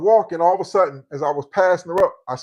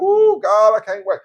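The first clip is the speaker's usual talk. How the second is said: mock swoon, high and louder; excited swoon; 5 semitones higher, 6 dB louder, slower than usual. Imitating a smitten guy hearing her voice. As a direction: ooh over her voice like a fan. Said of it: "you be like".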